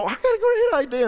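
mock eager pitch jump, proud of a great idea; excited pitch. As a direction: pitch it way up like a kid bursting with an idea. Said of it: "You come up with this thing and go".

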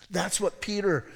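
ordinary delivery

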